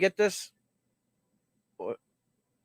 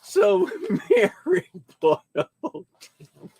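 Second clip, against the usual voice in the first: laughing; 2 semitones higher than usual. Real, not acted. Laughing at a big order.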